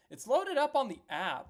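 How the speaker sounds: high, loud, frustrated protest; exasperated yelling. Real, not acted. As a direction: yell it at the uncooperative website. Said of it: "I'm sorry for yelling at you".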